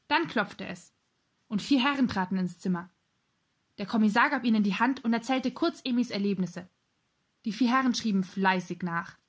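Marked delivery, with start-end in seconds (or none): none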